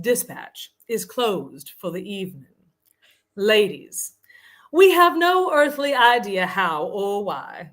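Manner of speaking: hammed-up Southern proclamation; affected Southern accent. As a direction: read it like a grandly formal Southern lady. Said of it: "in this very affected Southern accent".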